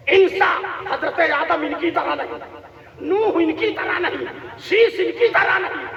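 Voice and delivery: fervent list in an even, driving cadence; rhythmic preaching. Real, not acted. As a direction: build name after name to a ringing peak. (जोशीली, लयदार तक़रीर में नाम गिनाना)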